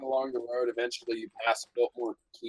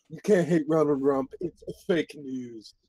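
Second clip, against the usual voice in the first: Trump impression; 2 semitones higher, slower than usual. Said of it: "You sound more like a surfer dude than Trump".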